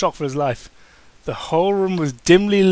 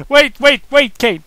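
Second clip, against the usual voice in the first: pleading; 6 semitones higher, 6 dB louder than usual. Acted. Pleading Kane to stop.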